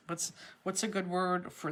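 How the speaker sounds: ordinary talk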